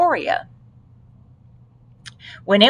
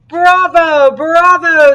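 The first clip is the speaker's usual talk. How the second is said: higher, loud bravo cheer; 9 semitones higher, 8 dB louder, slower than usual. Acted. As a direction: cheer like an audience. Students cheering.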